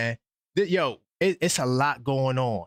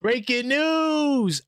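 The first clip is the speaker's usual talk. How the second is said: slow, drawn-out, higher announcer call; hammed-up breaking news announcement; 9 semitones higher, much slower than usual. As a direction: stretch it out like a siren headline.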